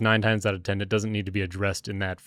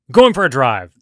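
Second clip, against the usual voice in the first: mock curt exit; 4 semitones higher, 11 dB louder than usual. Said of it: "Or not even say where you're going".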